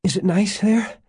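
high, eager question; high-pitched excited wonder; gasped. A narrator's reading. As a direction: ask full of breathless hope. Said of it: "Janet gasped".

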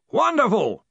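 high, hearty shouted exclamation; shouting. A narrator's reading. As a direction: cut in with breezy gusto. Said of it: "shouted Ridcully".

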